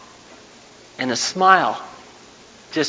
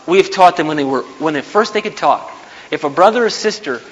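ordinary delivery

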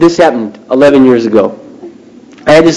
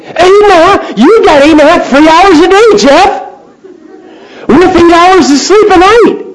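astonished. Retold or imitated. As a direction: act stunned at how much free time he has. Mock amazement at free hours.

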